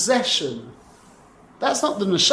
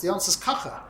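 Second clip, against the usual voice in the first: ordinary delivery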